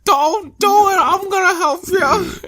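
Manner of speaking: tearful falsetto whine, drawn out; hammed-up high crybaby voice; mock whiny son. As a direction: wail it like a blubbering kid. Mocking Choji pleading with his dad.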